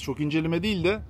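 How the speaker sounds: ordinary delivery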